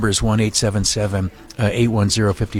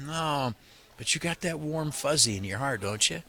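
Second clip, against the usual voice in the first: dropped to a near-hush, much softer; gentle confiding appeal, much softer; soft and intimate; 5 semitones higher, 10 dB quieter than usual. Real, not acted. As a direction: lean into the mic and speak close.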